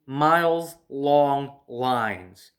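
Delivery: "miles long lines" stretched out very slowly, each word weighted; drawn-out emphasis. Real, not acted. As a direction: lean hard on "miles long lines" to show the size. Stressing how long the lines were.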